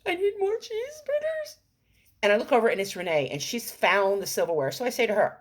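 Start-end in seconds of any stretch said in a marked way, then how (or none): 0.1-1.6 much higher put-on whiny voice; whiny self. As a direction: whine it like a needy child. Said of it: "And I was getting a little".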